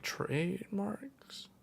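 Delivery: slow, whispered guess with a rise; unsure whisper. Real, not acted. Guessing what the Spanish ad says, whispered. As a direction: breathe the word as a doubtful guess.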